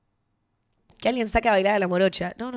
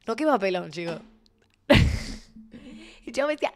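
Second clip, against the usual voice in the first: usual speaking voice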